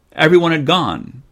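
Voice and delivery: usual speaking voice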